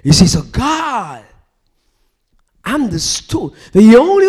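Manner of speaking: loud, rising sermon cry; fiery preaching. Acted. Preacher crying out to the congregation, fiery. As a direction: preach it out with full force.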